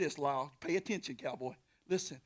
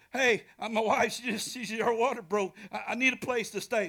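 frantic husband; 6 semitones higher, 8 dB louder than usual. Retold or imitated. Joseph pleading at the door.

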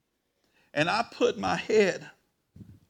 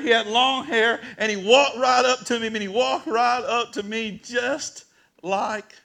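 louder, higher preacher's shout; full-force preaching; 7 semitones higher, 8 dB louder than usual. Acted. Pastor preaching his God encounter to the congregation, loud and rising. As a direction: preach it out to the whole congregation, laughing through it.

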